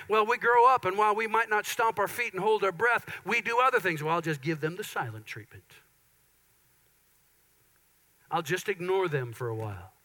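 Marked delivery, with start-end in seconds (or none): 4.0-9.9 low, soft sulky mutter; sulky aside. Retold or imitated. A spouse sulking in silence, low and muttered. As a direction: mimic a sulking spouse under the breath. Voicing someone giving silent treatment.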